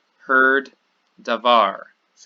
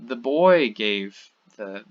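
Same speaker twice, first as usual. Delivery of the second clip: put-on "boy" louder and drawn out; emphasis on boy; 6 dB louder, slower than usual. Shown as a demonstration. Teacher showing that the subject is emphasized, stressed "boy". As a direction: lean hard and slowly on "boy". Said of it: "that's the emphasis".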